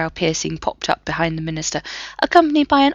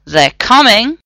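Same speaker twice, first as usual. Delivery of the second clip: strained; 5 semitones higher, 8 dB louder, slower than usual. A narrator's reading. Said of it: "Ronan sounded strained".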